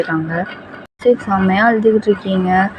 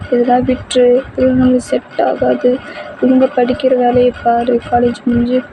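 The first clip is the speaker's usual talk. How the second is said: tearful; 5 semitones higher than usual. Acted. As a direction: confess it haltingly in a high, upset voice. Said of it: "செம்மையா அழுகுறீங்க".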